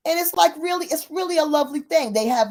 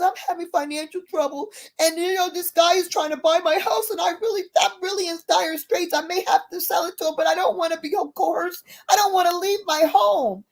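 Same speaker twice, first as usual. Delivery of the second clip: higher-pitched, distressed retold plea; lightly voiced old lady; 6 semitones higher than usual. Old lady tells neighbor her money troubles, lightly voiced distress.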